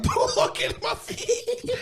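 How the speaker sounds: high, slow, breathless laughing plea; laughing panicked plea. Retold or imitated. To the shop assistant spotting fishnets, squealing through laughter. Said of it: "I was like".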